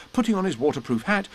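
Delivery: usual speaking voice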